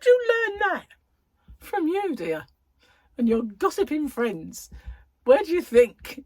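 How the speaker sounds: shocked mum. Retold or imitated. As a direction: gasp it out in disbelief. Mum and child exchange, retold.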